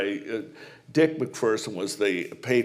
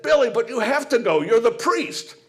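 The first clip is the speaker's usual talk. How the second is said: put-on high, louder mother calling the kids; nagging mom; 11 semitones higher, 6 dB louder than usual. Mother calling her kids to breakfast before mass, high and nagging. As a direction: chivvy the children down to breakfast. Mom's punchline.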